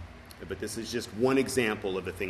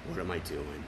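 quiet self-reproach; 6 semitones lower, 7 dB quieter than usual. Retold or imitated. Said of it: "right away I go".

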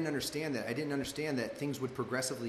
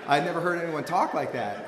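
louder, talking through laughter; laughing; 3 semitones higher, 7 dB louder than usual. Real, not acted. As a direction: keep laughing as you say it. Still laughing at friend's phrase.

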